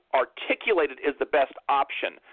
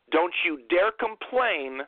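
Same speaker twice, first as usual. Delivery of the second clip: ordinary delivery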